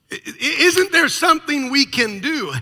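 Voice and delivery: stammer, begging; patient pleading with the doctor, high and loud; desperate plea. Retold or imitated. John begging again.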